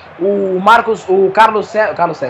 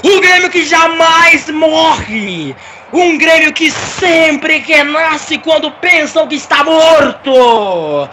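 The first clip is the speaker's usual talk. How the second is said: slow, shouted stadium narration; title-winning goal call; 5 semitones higher, much slower than usual. Acted. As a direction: roar out the championship goal. Live goal call for Grêmio. (narração épica de título)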